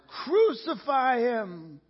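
high, long drawn-out shout; stretched, high-pitched crowd cry; roaring mob. Acted. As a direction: roar it slowly like a mob. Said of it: "the mob roared even louder".

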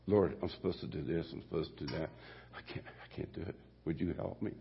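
soft, pleading prayer trailing to a breath; a quiet, sincere prayer to the Lord; humble prayer. Retold or imitated. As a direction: pray quietly for help. Prayer for help, retold.

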